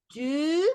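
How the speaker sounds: TU stretched with a rising tune; long sound. Shown as a demonstration. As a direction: hold the vowel in "tu". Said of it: "que tenían un sonido largo". ("tu" con sonido largo)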